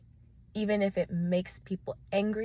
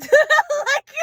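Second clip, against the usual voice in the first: loud laugh; 16 dB louder than usual. Real, not acted.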